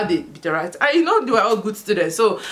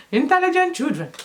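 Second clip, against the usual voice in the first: INTELLIGENT CHILDREN in a high put-on voice; playful high-voiced repeat of "Intelligent children"; put-on emphasis; 8 semitones higher than usual.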